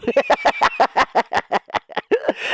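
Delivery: long cackle in character; cartoon laugh. Mouse after pleading for visitors, long cackle.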